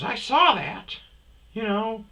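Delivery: high-pitched retold lady's voice; imitated lady. Voices the lady who walks up.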